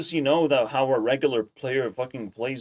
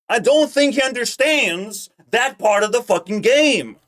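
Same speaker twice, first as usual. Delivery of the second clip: loud, high exasperated rant; raised-voice venting; pissed off; 8 semitones higher, 7 dB louder than usual. Real, not acted. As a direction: push every phrase out in real irritation. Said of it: "It pisses me off".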